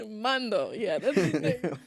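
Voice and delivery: real giggles breaking through the words; laughing. Real, not acted. Friends tease her over the slipped name, helpless laughing. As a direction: talk while cracking up.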